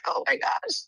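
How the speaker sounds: very high, squeaky mock despair; mock exasperation. Worker retelling a caller who won't hang up, mock-exasperated. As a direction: squeal it as if helplessly stuck on the call.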